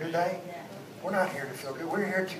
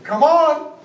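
a grinning, playful Come on, a little higher and louder; Jesus, teasing and amused; 7 semitones higher, 12 dB louder than usual. Retold or imitated. Jesus grinning at Peter as he calls him to come on. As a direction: call him on with a grin. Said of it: "I know that same grin that Jesus had".

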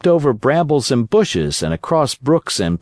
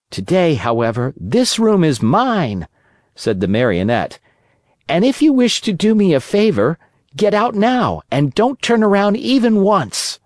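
put-on higher, cheeky wooden boy; puppet boy voice; 7 semitones higher than usual. Pinocchio ordering the cricket out, cheeky higher puppet voice.

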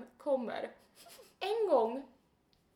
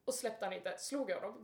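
ordinary talk, nothing marked

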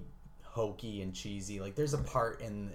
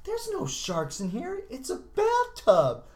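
much higher, slow, hammed-up reply; mock bad actor; 6 dB louder, slower than usual. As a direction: deliver it like a terrible porn actor. Voicing the guy in bathtub.

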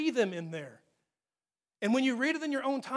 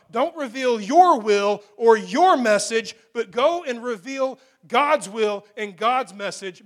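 loud, slow, hammering each line; full-force preaching; 8 dB louder than usual. Acted. Preacher driving the warning home to the congregation, loud and slow. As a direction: thunder it out to the congregation.

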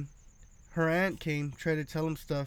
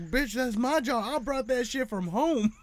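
hamming up the outrage over his own jar; mock outraged retort; 7 semitones higher than usual.

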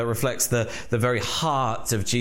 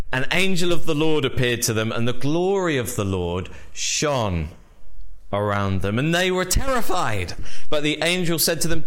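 reading the verses aloud with lifted weight; scripture reading; 3 semitones higher than usual. Acted. Pastor reading the angel's words to the congregation, reverent. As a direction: read the Bible passage to the church.

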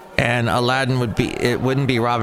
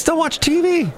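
mock urging. Urging listeners off TV.

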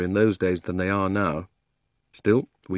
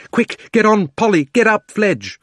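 urgent high yell to the others; shouting; 12 semitones higher, 9 dB louder than usual. A narrator's reading. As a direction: shout it out in panic. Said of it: "was shouting out".

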